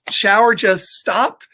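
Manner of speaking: usual speaking voice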